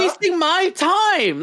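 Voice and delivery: high, slow sarcastic mock voice; high slow sarcastic parody; mocking drawl. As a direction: stretch it out like a sneering brat. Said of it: "mocking the opponent".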